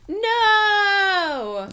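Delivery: high, loud panicked yell; animal cry. Acted. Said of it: "Evan uttered an animal cry".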